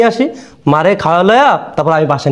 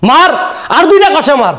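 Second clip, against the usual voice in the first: urging call; 7 semitones higher than usual. Acted. Urging to hit harder.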